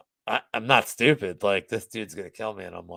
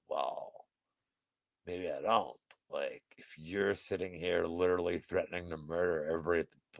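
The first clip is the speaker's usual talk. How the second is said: low, quieter measured comeback; retold calm reasoning; 6 semitones lower, 6 dB quieter than usual. Bar manager answering a threatening customer, low and measured. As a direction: reason with him in a lowered voice.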